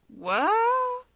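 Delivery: high, startled squeak of disbelief; shocked what. Acted. Rumi hearing she's joining a support group, stunned. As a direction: blurt it out in total surprise.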